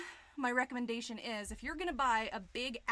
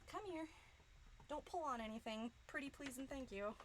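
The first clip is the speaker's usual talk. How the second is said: much softer, coaxing; coaxing softly; 3 semitones higher, 9 dB quieter than usual. Real, not acted. Streamer to the screen, coaxing it closer softly. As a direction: sweet-talk it gently.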